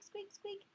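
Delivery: squeaks. Retold or imitated. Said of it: "It probably sounded like squeak".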